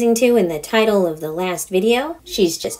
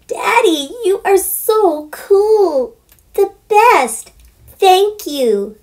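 put-on very high, slow gushing little girl; adoring girl; 12 semitones higher, 3 dB louder, much slower than usual. Daughter thanking her father, very high and gushing. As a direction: fawn over Daddy like a delighted child. Heather voiced in story.

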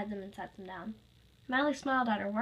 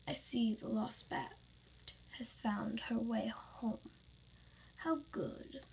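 slow, soft, whispery villain; whispered villainous satisfaction; sinister gloating; 6 dB quieter, slower than usual. Acted. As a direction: gloat in a hushed drawn-out voice. Villain gloats over returning victim.

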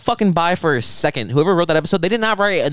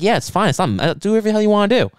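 higher, hurried retold brush-off; exasperated giving-in; 7 semitones higher, faster than usual. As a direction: act out waving the question away, pitched up. Retold exasperated reply to date.

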